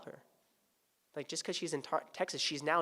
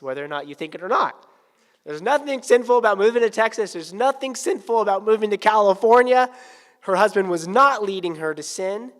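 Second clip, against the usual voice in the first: loud, high, insistent preaching; full force preaching; 6 semitones higher, 14 dB louder than usual. Acted. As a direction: hammer the point home to the church.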